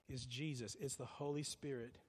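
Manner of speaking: ordinary talk, nothing marked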